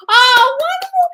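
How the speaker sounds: very high, loud, slow wonderful; gushing delight. Real, not acted. Cheering a fan's FaceTime news, soaring delight. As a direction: soar up and savour the word.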